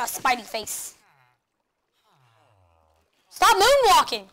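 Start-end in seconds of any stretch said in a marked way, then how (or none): 3.3-4.3 loud playful indignation; mock outrage. Real, not acted. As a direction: yell it with fake outrage. Joking outrage at skeleton.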